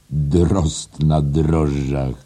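called out. A narrator's reading. Professor mocking a man by his nickname, called out. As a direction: call it out slowly. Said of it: "zawołał".

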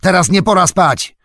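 high, loud scolding shout; orc yell. A narrator's reading. Orc driver rousing two soldiers slumped by the wall, yelling. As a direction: shout them awake, full force. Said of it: "wrzasnął".

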